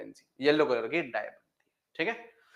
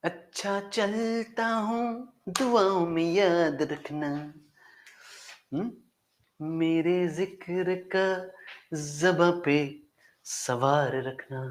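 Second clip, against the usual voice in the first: slow, drawn-out recital of a couplet; poem recitation; 3 semitones higher, 4 dB quieter, much slower than usual. Acted. Teacher reciting a farewell couplet to his students, slow and soulful. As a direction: savour each line like a farewell verse.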